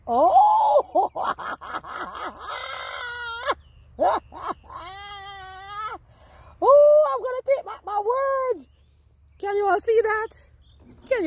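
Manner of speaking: high, loud squeal of delight with a laugh; excited shriek. Real, not acted. Gardener showing viewers her surprise potatoes, excited shriek. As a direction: shriek like you just struck gold.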